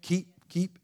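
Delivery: "keep" repeated and hammered, slow and heavy; stress on KEEP. Shown as a demonstration. Preacher hammering the word keep at the congregation. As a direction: drive "keep" home again and again. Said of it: "emphasizing the word keep".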